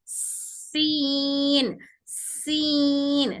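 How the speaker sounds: सीन stretched very slowly with a long airy s; very slow teaching demo of सीन; stretched seen. Shown as a demonstration. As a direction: hold the hiss in सीन. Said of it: "सीन बोलकर देखें".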